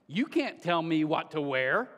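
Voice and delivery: slow, defiant middle-schooler snap; mock the kid's defiant head snap; sassy kid. Wife quoting students defying dress code, mock sassy. Kids snapping at teacher.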